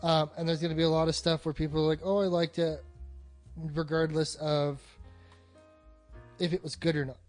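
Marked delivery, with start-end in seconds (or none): none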